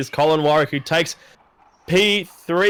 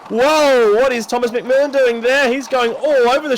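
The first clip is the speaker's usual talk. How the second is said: excited call; 7 semitones higher, 4 dB louder than usual. Real, not acted. Sudden incident in the race.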